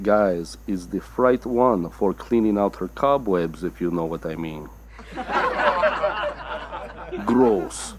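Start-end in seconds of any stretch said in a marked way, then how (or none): 5.0-7.2 wheezy squeal of a laugh; high breathy cackle. Acted. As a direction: cackle at your own dirty joke. Host laughs at own joke.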